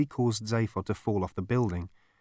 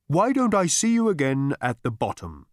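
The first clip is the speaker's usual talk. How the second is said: higher, airy and receding; fading ethereal voice; 5 semitones higher, 4 dB louder than usual. A narrator's reading. Voice parting from Zaphod, drifting off and fading. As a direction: start raised, then fade away. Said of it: "it faded".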